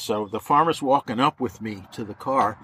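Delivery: ordinary talk, nothing marked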